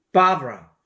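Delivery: forceful English Barbara; belly voice, loud. Shown as a demonstration. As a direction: drive 바브라 out from the gut. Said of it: "배로 소리를 내니까".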